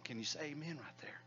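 whispered aside to the congregation. Real, not acted. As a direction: breathe the question out in a whisper. Asks amen very softly.

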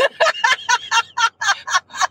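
falling burst of laughter; laughing. Real, not acted. Laughing at joke.

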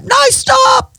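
high-pitched exasperated cry; freak-out. Retold or imitated. Boss retells freaking out at her PA, high shouted protest. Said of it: "I, I, I freak out".

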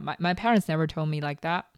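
ordinary delivery

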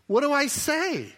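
high, loud, hammed-up desperation; exasperated pleading. Preacher acts Isaiah begging for words, exasperated pleading. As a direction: beg loudly to be given the words.

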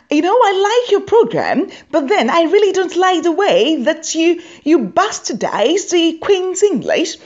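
high, prim Queen's English voice; posh critic. Retold or imitated. Comedian mocking a critic: a high, prim Queen's English voice. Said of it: "this is what he had to say".

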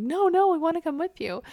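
high-pitched mock child plea; imitated begging kids. Mom imitating kids who want to follow her inside, pleading.

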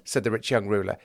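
ordinary delivery, nothing marked

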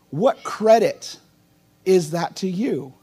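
"what credit" drawn out slowly, each word weighed; slow right down on "what credit is that to you?". Shown as a demonstration. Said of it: "But notice what Jesus says".

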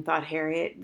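plain reading voice

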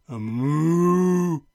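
ordinary delivery, nothing marked

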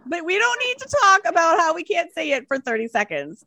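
high-pitched, impatient plea to a podcast host; exasperated listener. Retold or imitated. Listener telling podcasters to get on with the story, mock exasperation. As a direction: act out a fed-up listener hurrying them along. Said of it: "I'm like".

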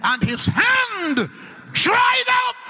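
high ringing preacher shout, dragged out slow; preaching. Acted. Preacher proclaiming the word to the congregation. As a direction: call it out to the whole church.

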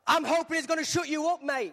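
irritated cellmate's sarcastic jab; snarky Silas. Retold or imitated. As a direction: snap back with sarcasm. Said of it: "Silas is like".

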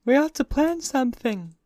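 lazy yawned line; yawning. A narrator's reading. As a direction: say it through a yawn. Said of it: "yawned Miss Baker".

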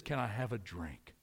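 soft, low, simple request; a hushed, humble Jesus asking for a drink; gentle Jesus voice. Acted. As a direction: ask it quietly, with tenderness. Jesus quoted asking for water.

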